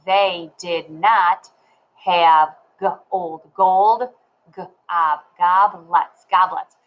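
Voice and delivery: slow word-by-word reading with gaps; modelled decoding. Shown as a demonstration. Teacher showing how to read sight words, slow sounding-out. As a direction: sound out "gold" and "goblets", then blend them. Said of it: "I'm just gonna read".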